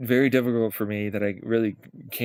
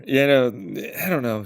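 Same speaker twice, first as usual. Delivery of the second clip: normal talking voice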